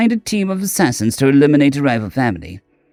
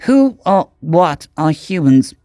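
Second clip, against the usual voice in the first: perplexed; 5 semitones higher, slower than usual. A narrator's reading. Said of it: "Shaz looked perplexed".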